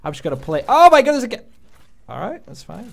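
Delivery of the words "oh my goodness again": loud, high "oh my goodness"; exasperated outburst. Real, not acted. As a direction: blurt it out in disbelief. Killed yet again.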